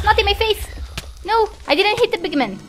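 panicked protest. Real, not acted. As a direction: fend it off in a loud, high panic. Under ghast attack, pleading and shooing.

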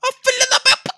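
sudden high, loud curse; cursing outburst. Real, not acted. Streamer cursing at the game, sudden loud outburst. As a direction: explode at the screen. Gamer cursing a missed moment.

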